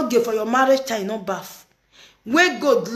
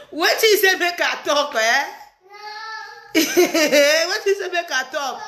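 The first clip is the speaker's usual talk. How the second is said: incredulous question broken up by laughter; higher-pitched, laughing as you ask it; 4 semitones higher than usual. Real, not acted. Asking what she is supposed to say, laughing.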